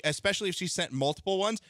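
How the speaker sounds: ordinary talk, nothing marked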